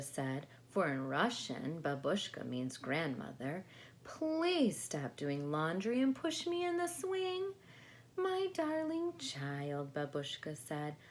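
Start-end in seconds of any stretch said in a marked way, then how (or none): 4.0-7.5 put-on much higher, louder pleading child; high whiny child begging grandma; little girl voice. 8.2-9.9 higher, slow and fond; slow doting grandma voice; tender grandma. Acted.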